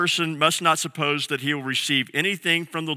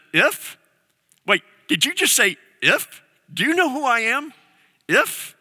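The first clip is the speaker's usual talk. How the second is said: higher, incredulous mock outrage; indignant Jesus; 7 semitones higher than usual. Jesus challenging a doubting father, incredulous. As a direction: sound amazed he doubted you.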